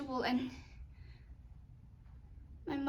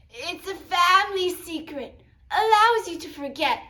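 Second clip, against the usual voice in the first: higher, loud grandmother voice; grandmother Popo; 5 semitones higher, 10 dB louder than usual. Acted. Popo lets her grandchild in on the family secret, loud and emphatic. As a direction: declare it loudly in Popo's higher voice.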